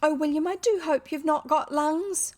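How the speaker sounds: put-on high, tender child voice; anxious little girl. A narrator's reading. Girl hoping boy is not ill, anxious. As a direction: plead with girlish concern. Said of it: "Her tender, anxious little face".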